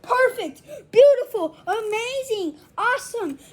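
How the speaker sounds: loud, gushing over-the-top praise; excited praise. Pretend. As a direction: sell it like an ad. Exaggerated praise of marshmallow.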